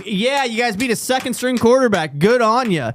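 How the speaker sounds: mocking heckle.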